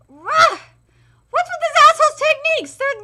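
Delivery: high, loud exasperation; frustrated outburst. Acted. Fighter baffled by enemy's new moves, angry. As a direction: snap out the complaint. Character's outraged remark.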